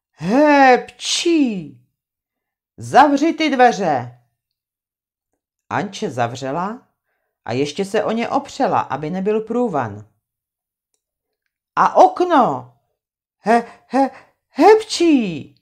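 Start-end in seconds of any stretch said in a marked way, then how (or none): 0.1-1.8 loud, high exaggerated sneeze; huge sneeze. Trautenberg sneezes with his head cold, loud sneeze. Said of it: "kýchl tak strašlivě". 2.8-4.2 louder, higher, slow command; angry order. Acted. Trautenberg orders Anče to shut the door, angry. 11.8-15.6 loud, high build into a sneeze; sneezing fit. Acted. Trautenberg demands the window shut, then sneezes loudly.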